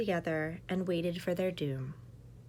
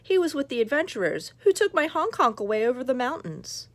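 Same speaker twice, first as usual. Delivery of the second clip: quick, louder troll complaining; troll rushing through his loss; lightly voiced troll; 4 semitones higher, 6 dB louder than usual. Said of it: "said the troll".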